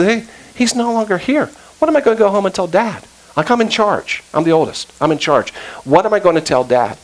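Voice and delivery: put-on high, rushed panic over the missing boy; a frantic voice, scared of facing Dad; panicked older brother. Oldest brother panicking at the empty pit, high and rushed.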